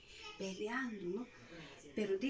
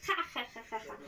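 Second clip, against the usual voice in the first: mock taunting laugh; playful jeering ha ha; mocking laughter; 2 semitones higher than usual. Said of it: "gente que se reía".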